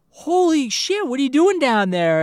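high, loud shocked outburst; startled shout. Retold or imitated. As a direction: yell in sudden fright. Sees clown come out of sewer.

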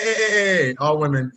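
ordinary talk

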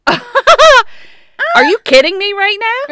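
high, slow, loud mock outrage; epically dramatic. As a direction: stretch out each incredulous question. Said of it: "being epically dramatic".

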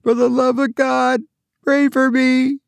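high, distant wailing plea; half wailing. A narrator's reading. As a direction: wail it half singing, far away. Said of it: "half wailing, half singing".

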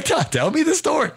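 squeaky high mock prompt; high-pitched mock urging; eager prompt. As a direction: pipe it up high like an eager producer. Host mimics producer's eager prompt.

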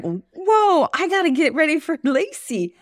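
high, startled retold exclamation; a sudden jolt of realizing the time; surprised. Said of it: "I was like".

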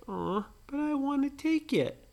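Brady begging his brother, whiny; whiny little boy. Acted. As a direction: whine for the toy. Brady whining.

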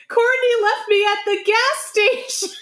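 high, drawn-out fake wail; mock distress. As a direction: play a stranded friend complaining. Acting out fake distressed caller.

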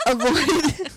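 ordinary delivery

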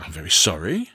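sorry, drawn low; low apologetic voice. A narrator's reading. Going apologising.

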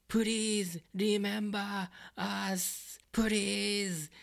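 put-on high, drawn-out yell in broken English with a Japanese accent; imitated heavy Japanese accent. Reviewer mimics the Afro guy yelling, heavy Japanese accent. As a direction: mimic a pleading yell in a thick Japanese accent, stretched out slowly. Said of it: "yelling in this broken English".

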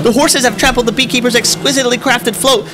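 frantic, high, loud commentary; shrieking as the parade falls apart; panicked commentators. Acted. Parade float chaos.

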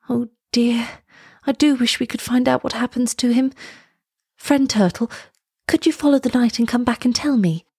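put-on high-pitched voice of a pleading girl; girl character appealing to a friend; Elsa, wishful.

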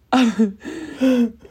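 short real laugh bursting out; laughing as she reads the card; burst of laughter. Real, not acted. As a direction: laugh in disbelief.

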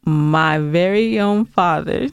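ordinary talk, nothing marked

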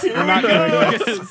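slow, loud, laughing refusal; loud laughing protest. Real, not acted. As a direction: drag it out loud through a laugh. Refusing the bit, repeated.

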